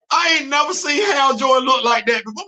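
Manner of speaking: incredulous shout. Real, not acted. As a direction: yell it in outraged amazement. Outraged at Hal Jordan's look.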